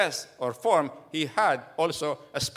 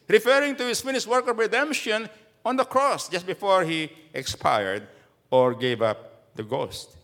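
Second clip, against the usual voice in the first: ordinary delivery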